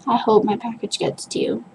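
ordinary delivery

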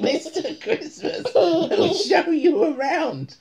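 high, loud, giggling read; can't keep a straight face; laughing as she voices the character; laughing through the line. Acted.

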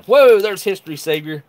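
loud, high delighted whoa; light up at a familiar name; excited. Real, not acted. Spotting a super chat donor.

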